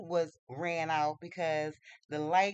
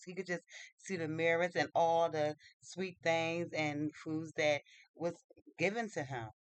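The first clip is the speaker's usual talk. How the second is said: ordinary delivery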